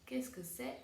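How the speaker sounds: normal talking voice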